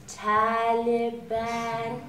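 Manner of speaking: very slow, held on a level note; drawn-out foreign word. Retold or imitated. Comedian recalls the next word heard in class, slowly intoned. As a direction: stretch every syllable, almost intoned.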